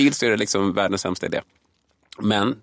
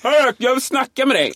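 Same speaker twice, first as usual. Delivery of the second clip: confrontational guy; 5 dB louder than usual. Retold or imitated. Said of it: "Så när snubben säger".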